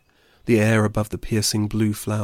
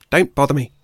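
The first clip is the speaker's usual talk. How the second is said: irritated; 4 semitones higher, 7 dB louder than usual. A narrator's reading. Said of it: "she sighed".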